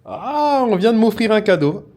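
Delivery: high, loud burst of delight; thrilled. Real, not acted. Vlogger tells viewers he was given a present, thrilled. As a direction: open on a squealed "ah" and stay excited. Thrilled at a gift just received.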